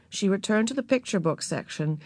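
ordinary delivery

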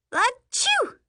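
loud sudden sneeze. Acted. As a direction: let out a big sneeze. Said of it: "That sneeze came out of nowhere".

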